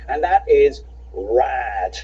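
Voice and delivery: rad drawn out slowly; slow stress on rad. Real, not acted. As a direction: savor the word rad. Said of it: "the only word I could really summon".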